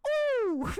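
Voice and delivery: very high, loud mock yelp; imagined yelp. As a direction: squeal out his imagined cry. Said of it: "I can envisage a noise he makes".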